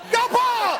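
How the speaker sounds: loud, high-pitched trackside shout; yelled cheer. Acted. Actor acts a fan as the car comes around, yelled cheer. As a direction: holler after a speeding car. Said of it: "get a chance to yell".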